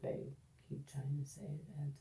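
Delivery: quick muttered whisper; whispered aside. Real, not acted. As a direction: whisper it under breath.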